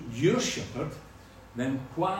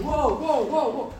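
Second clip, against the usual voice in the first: louder, higher calls of whoa; farmer calling whoa; 10 semitones higher, 6 dB louder than usual. Retold or imitated. Farmer trying to halt the cows, calling whoa. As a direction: call out whoa to stop the cattle. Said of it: "the farmers out there going".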